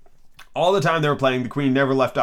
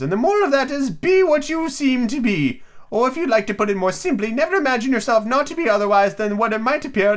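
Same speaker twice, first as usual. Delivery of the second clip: Duchess voice; 10 semitones higher than usual. Acted. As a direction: hold the Duchess's high sing-song. Duchess voiced in read story.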